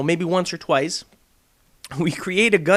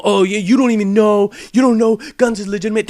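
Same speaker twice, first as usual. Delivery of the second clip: louder, a hint of a defensive whine, fast; mock defensive fan; 3 semitones higher, 5 dB louder than usual. Vlogger mocking a fan defending him, mild indignant whine. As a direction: rattle it off like an indignant fanboy. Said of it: "respond to them by saying".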